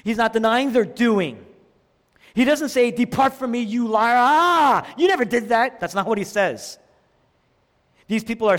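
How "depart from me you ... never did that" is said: raised, loud imitated denunciation; mock angry rebuke. Preacher acting what Jesus doesn't say, mock angry. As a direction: denounce them in mock outrage.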